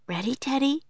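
talking to a dog. A narrator's reading. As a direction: coax the puppy along. Asking the dog.

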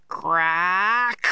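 long drawn-out, rising word; imitated crack. A narrator's reading. Narrator imitating the ship's noise, drawn-out crack.

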